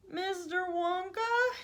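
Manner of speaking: put-on high, drawn-out boy's call. A narrator's reading. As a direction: call out above the noise. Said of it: "he yelled above the noise".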